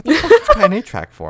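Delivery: high, loud mock mom voice; imitating mom. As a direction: do your mom, squeaky and loud. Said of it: "She's like".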